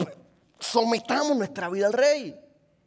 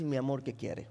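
knowing dad; 7 semitones lower, 12 dB quieter than usual. Retold or imitated. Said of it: "y tú dices".

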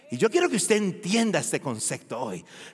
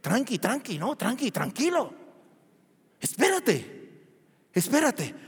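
softer calming repetitions, slowing on espérate; soothing hush; 4 dB quieter than usual. Retold or imitated. The verse calming an anxious listener, soft and soothing. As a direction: hush someone gently, easing them down. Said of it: "te está diciendo solamente".

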